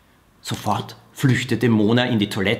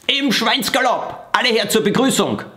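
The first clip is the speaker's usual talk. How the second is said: commanding call; 10 semitones higher than usual. Acted. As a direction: bark the order like a drill sergeant pig. Pig babysitter summoning children.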